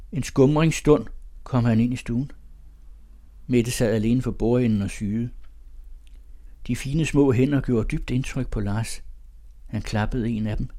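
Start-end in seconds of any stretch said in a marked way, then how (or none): none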